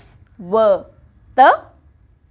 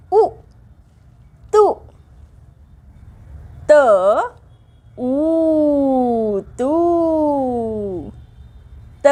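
put-on lively chanting of syllables for children; teacher leads a letter drill; 2 semitones higher, much slower than usual. Shown as a demonstration. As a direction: sing each syllable out to little pupils. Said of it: "त या उच्चाराचा सराव".

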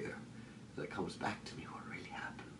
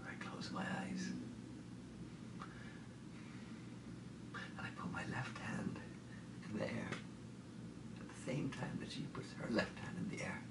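soft whispery delivery with long pauses; hushed surrender; 2 semitones higher, 8 dB quieter than usual. Acted. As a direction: murmur it as if drifting into sleep. Sinking back into the dream.

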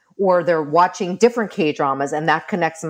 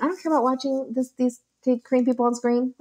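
put-on higher, fast dismissive teen voice; mocking teen whine; 6 semitones higher, faster than usual. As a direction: whine it like a bratty kid who doesn't care. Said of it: "I was like".

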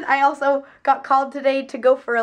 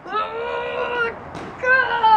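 sudden high cry of alarm; startled; 12 semitones higher than usual. Real, not acted. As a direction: yelp it out in shock. Startled on the set.

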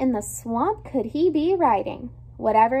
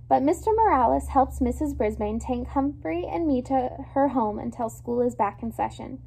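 plain reading voice

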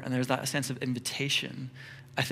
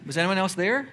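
nosy question, pitched up; 6 semitones higher, 5 dB louder than usual. Pretend. Friend quizzing, acted dialogue.

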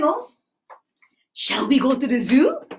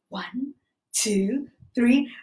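ordinary delivery

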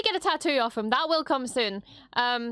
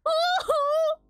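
much higher, delighted cheer; excited whoop. Real, not acted. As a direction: whoop with joy at the screen. Cheering as the show starts.

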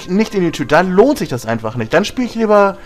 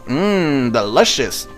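mock savouring taste; playful mm delicious; much slower than usual. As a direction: pretend it tastes great. Tasting medicine.

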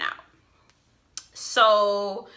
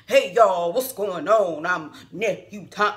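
mock MC voice; 6 dB louder, slower than usual. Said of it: "in his best M C voice".

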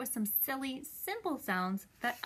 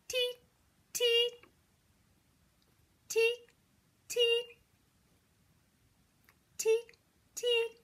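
very high, short bird cheeps; high-pitched imitated birdcall; bird tweets; 12 semitones higher, slower than usual. Shown as a demonstration. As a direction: chirp like a little bird on one high note.

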